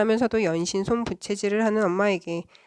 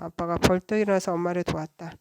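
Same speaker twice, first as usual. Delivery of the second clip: ordinary delivery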